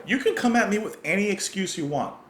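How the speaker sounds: defiant lyric read out, pitched high; defiant quote. Retold or imitated. Reactor voicing the singer's challenge to a liar, defiant.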